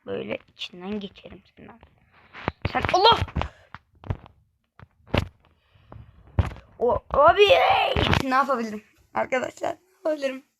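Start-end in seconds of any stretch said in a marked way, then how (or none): 2.7-7.9 loud, higher burst of surprise; startled exclamation. Real, not acted. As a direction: yelp at the screen as it happens. Sudden game moment, exclaims. (ani şaşkınlık çığlığı)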